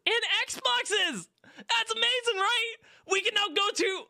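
high, bright-eyed announcer pitch; hammed-up newscaster handing off to the next segment; mock anchor hype. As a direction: sell it like a cheesy TV host. Mock news-anchor segue.

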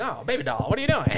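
old Hollywood transatlantic accent, hammed up; Katharine Hepburn impression. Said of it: "the way she talks like this".

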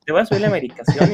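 laughing mock impression of a doctor; mocking psychiatrist. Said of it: "ya dependo yo la voz".

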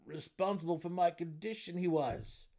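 high, loud declaration; emphatic. Acted. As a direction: declare it with force. Warden's emotional declaration.

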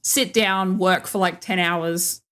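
usual speaking voice

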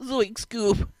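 high, loud cartoon Shaggy. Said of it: "that's all I got is Zoink, Scoob".

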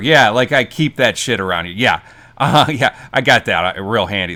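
loud, laughing sarcasm; hammed-up sarcastic shrug. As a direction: overplay the fake helpfulness.

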